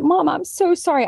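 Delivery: lightly voiced little girl, pitched high; a hint of a small sorry child; imitating child. Mother voicing her six-year-old's apology, high and childlike.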